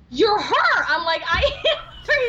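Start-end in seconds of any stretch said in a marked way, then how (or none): 0.1-0.8 high, excited realization; a fan realizes who she is, excited. Retold or imitated. Fan recognizing the actress, high and excited. 1.3-2.3 high, laughing and quick; laughing reply. Retold or imitated. Telling a starstruck fan yes, laughing.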